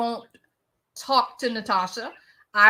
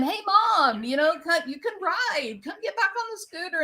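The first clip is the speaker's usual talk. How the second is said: higher, lightly voiced young son coaxing; son's voice; 7 semitones higher than usual. Son coaxing drunk mom back onto the scooter, higher, mock young voice. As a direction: call her back onto the scooter like the boy. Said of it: "the boy is going".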